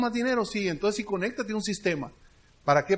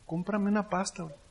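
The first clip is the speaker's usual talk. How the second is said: softer, downcast sales plea; lowered voice; 5 dB quieter than usual. Shown as a demonstration. Seller playing broke to a customer, lowered voice. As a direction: drop the voice as if hard up. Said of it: "Bajo el tono de voz".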